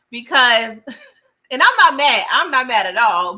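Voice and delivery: laughing protest. Real, not acted. Host insisting she isn't mad, laughing loudly.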